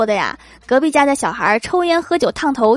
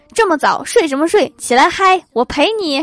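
higher, louder party-dad call; rowdy dad; 6 semitones higher, 3 dB louder than usual. Retold or imitated. Dad bangs on the door to party, mock excited. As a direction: rouse him like a hyped-up father.

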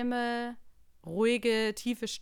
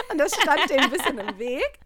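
laughing; 8 semitones higher, 9 dB louder than usual. Real, not acted. Laughing while telling.

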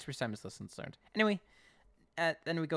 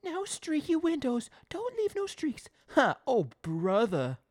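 high, drawn-out mocking echo of the boss; hammed-up exasperated grumble to oneself; grumbling aside; 5 dB louder, slower than usual. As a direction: act out a fed-up helper mimicking orders. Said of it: "like, an aside to himself".

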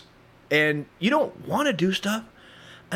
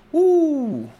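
high, loud mock whoop; startled whoop; 11 semitones higher, 6 dB louder than usual. Plato finds his stool wet, high startled whoop. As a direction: whoop in comic shock.

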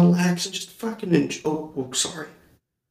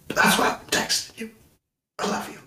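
whisper; 7 semitones higher, faster than usual. Real, not acted. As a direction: hush it like a secret. Repeat whispered tenderly.

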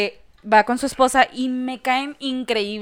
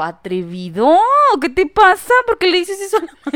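mock scolding; 6 semitones higher, 7 dB louder than usual. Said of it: "Y yo".

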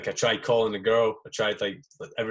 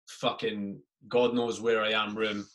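normal talking voice

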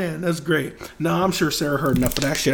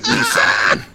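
high shout of the name; 6 dB louder than usual. Retold or imitated. Liam Neeson seeing himself in the mirror, high shout. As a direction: yell it in shock. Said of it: "It's like".

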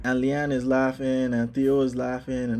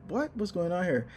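higher, puzzled mock alarm; retold confusion; 7 semitones higher than usual. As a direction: replay the moment of not understanding the fall. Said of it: "and I'm like".

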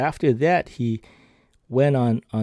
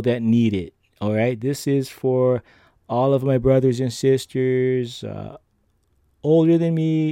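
raised, earnest appeal to the listeners; heartfelt address; 4 semitones higher than usual. Acted. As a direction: speak straight to the folks who are struggling, with feeling. Turns to address his listeners.

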